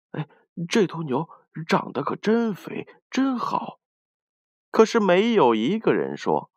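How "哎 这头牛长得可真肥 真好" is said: softer, higher crowd chatter; admiring onlookers. A narrator's reading. People commenting as the ox walks by, admiring. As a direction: marvel softly in a raised voice. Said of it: "人们纷纷议论说".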